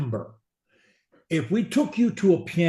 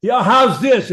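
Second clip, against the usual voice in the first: higher, louder mock question; mock loud student; 8 semitones higher, 10 dB louder than usual. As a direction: overdo his loud voice. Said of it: "That's the way you sound to me".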